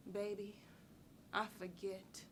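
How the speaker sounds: soft, low and sparing, with pauses; sweet, quiet deflection. Acted. Woman sweetly fobbing off a man about her scars, quietly. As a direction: let it drop softly, almost to yourself.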